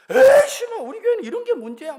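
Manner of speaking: sudden loud high curse, then fast grumble; angry outburst. Retold or imitated. Young man snaps at the pastor on the phone, angry outburst. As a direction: blurt out a swear in sudden anger. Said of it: "갑자기 그러는 거예요".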